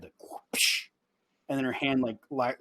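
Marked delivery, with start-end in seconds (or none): 0.0-0.9 unvoiced swish; whooshing sound effect. A hushed whoosh as the wife turns to him. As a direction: breathe out a quick whoosh for the foot. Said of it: "cocks to the side".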